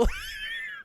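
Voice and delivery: high squealing laugh at own joke; corny dad laugh. Real, not acted. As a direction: giggle at your own roast. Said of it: "Sorry, I'm laughing at my own jokes".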